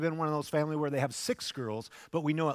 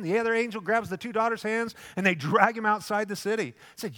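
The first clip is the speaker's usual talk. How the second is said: ordinary delivery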